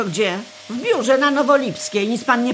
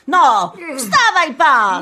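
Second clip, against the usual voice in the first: impatient shout; 3 semitones higher, 3 dB louder, slower than usual. Acted. Cleaner wakes drunk man.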